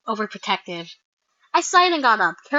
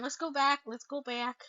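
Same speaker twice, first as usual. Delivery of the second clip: ordinary delivery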